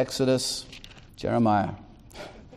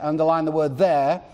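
usual speaking voice

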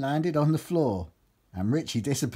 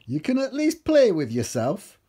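higher, insistent character voice; Steve haggling; 8 semitones higher, 4 dB louder than usual. A narrator's reading. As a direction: plead the bargain in a raised voice. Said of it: "But Steve said".

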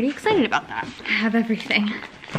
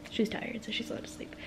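whispering; 13 dB quieter than usual. Real, not acted. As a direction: hush it down to a whisper. Toddler napping right in front.

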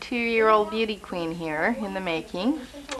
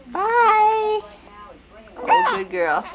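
high, louder praising coo; 12 semitones higher, 3 dB louder, much slower than usual. Real, not acted. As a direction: cheer her on up high. Praising baby.

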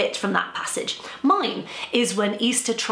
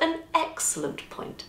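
quiet villain; 10 semitones higher, 5 dB quieter than usual. Acted. Villain interrupts smoothly.

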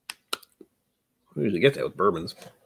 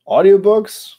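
surprised; 10 semitones higher, 7 dB louder, slower than usual. Real, not acted. Puzzled reading a chat request.

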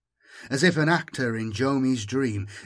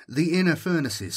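ordinary delivery, nothing marked

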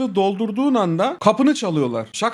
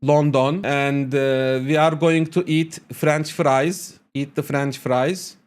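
English in a blatant Turkish accent; slow, plodding Turkish-accented English; thick Turkish accent; 3 semitones lower, slower than usual. Shown as a demonstration. Learner introducing himself and his England trip, Turkish accent. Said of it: "çok sırıtan bir Türk aksanı yapıyorum".

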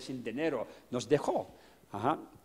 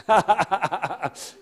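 laugh; 6 semitones higher than usual. Real, not acted. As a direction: burst into a high rolling laugh. Laughs recalling undone homework. (risa aguda y entrecortada)

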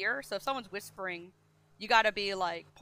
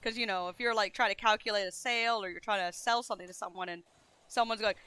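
ordinary talk

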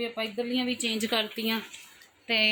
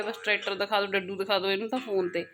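usual speaking voice

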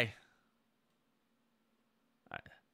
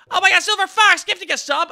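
higher and louder burst of joy; excited outburst; 7 semitones higher, 8 dB louder than usual. Real, not acted. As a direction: blurt it out thrilled. Reacting to another gifted sub.

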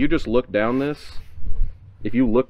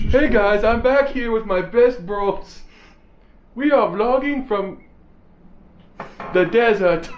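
high, drawn-out mock vlogger intro; put-on vlogger voice; 11 semitones higher, slower than usual. Host greeting viewers from the desert with best bros, put-on vlogger voice. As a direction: play an over-the-top vlogger, pitched up and slow. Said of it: "keep doing the voice".

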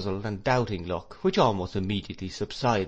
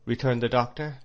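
ordinary delivery, nothing marked

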